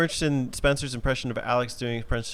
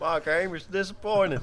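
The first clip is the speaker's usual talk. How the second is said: cartoon cowboy; 4 semitones higher, 6 dB quieter than usual. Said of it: "Yosemite Sam over here".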